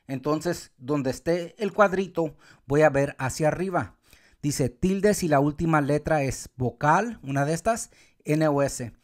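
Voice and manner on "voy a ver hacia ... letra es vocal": normal talking voice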